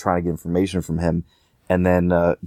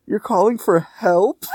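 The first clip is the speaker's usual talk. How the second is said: high, slow, mocking coo; mocking fake pity; 12 semitones higher, slower than usual. Pagan mocking the captive's text for help, high and slow. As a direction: sneer at the plea in a sing-song voice. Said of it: "He's like".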